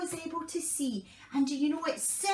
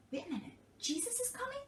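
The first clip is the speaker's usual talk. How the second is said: surprised; 7 semitones higher, 9 dB quieter, much faster than usual. Acted. Blind man hears news.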